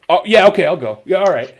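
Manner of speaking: mock agreeing. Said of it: "he was still like".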